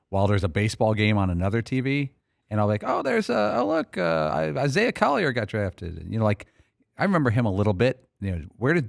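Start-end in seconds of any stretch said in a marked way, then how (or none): 2.8-6.0 high-pitched, distracted noticing of a pick; imagine glancing up at the draft; casual surprise. Sports host imagining himself half-watching the draft, casual surprise.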